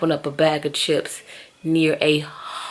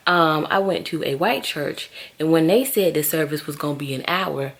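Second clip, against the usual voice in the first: normal talking voice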